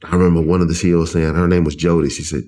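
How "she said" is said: ordinary delivery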